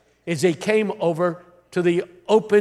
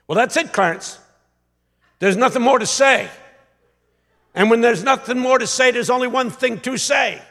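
building, raised preacher voice; imitated preacher's authority; 5 semitones higher, 5 dB louder than usual. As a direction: wind up toward the big finish. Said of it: "he said it with such authority".

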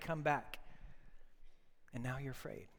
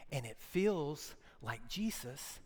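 lower, soft whispered words; 4 semitones lower, 10 dB quieter than usual. Acted. Preacher whispering to a frightened congregation. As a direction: whisper it close to the listener. Intimate sermon point, whispered.

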